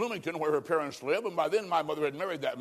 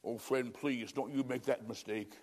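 soft plea; 5 semitones lower, 8 dB quieter than usual. Real, not acted. Pleading with listeners after climax.

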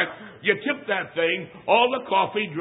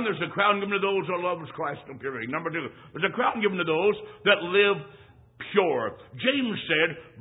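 ordinary talk, nothing marked

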